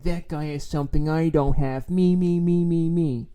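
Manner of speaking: put-on petulant whining; whiny complainer. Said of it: "like this whiny, bitchy, complaining".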